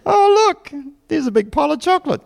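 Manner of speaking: high, delighted mock discovery; mock-innocent excitement; naive believer. Mock naive believer misreading signs.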